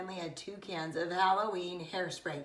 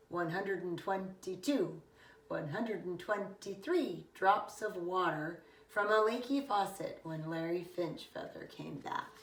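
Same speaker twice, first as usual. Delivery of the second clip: plain reading voice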